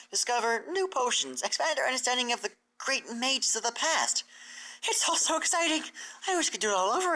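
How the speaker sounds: a gushing scholar mare; Twilight's voice. Acted. Twilight gushes about school.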